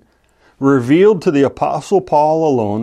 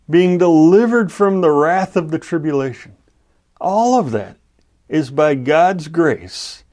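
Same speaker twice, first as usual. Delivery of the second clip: higher, rising list of blessings; fervent preaching; 4 semitones higher than usual. Acted. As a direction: build the joy line by line.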